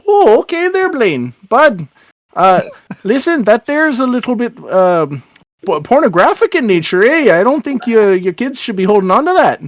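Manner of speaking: singsong lilt, hesitating delicately; folksy Irish-Canadian officer, awkward. Acted. Officer warning kids about indecent note, awkward folksy lilt. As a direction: stumble politely through an embarrassing warning. Tracy reacts to snatched note.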